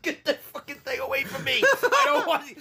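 high imitation yell breaking into laughter; mock yelling. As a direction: mimic a frantic yell, laughing by the end. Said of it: "Randy's yelling at him".

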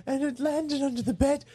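put-on high old lady voice, a little British; quavering granny voice, hint of British. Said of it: "She's a little British".

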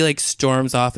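ordinary talk

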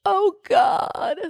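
a high, gasping mock "oh God"; a wife catching her husband cheating, high-pitched and shocked. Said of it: "And she's just like".

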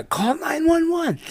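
high, slow, soft mock whimper; panicked guy. Pantsed guy telling girlfriend to call, high slow mock whine. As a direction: whine it like a helpless victim.

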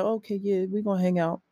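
softly agreeable, unbothered; calm and collected. Retold or imitated. Randy agreeing with his ex, calm and cool. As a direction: go along coolly, a little softened. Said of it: "calm, cool, and collective about it".

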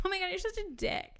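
quick playful high tease; joking. Shown as a demonstration. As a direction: toss it off as a joke. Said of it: "it can be, like, joking".

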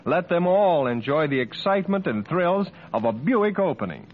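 usual speaking voice

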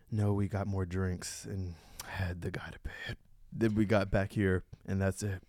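low, slow character voice; sleepy-morning love interest. Acted. Arthur answering about last night, low and slow.

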